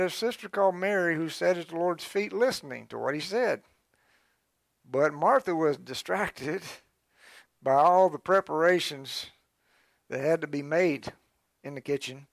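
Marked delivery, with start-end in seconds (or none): none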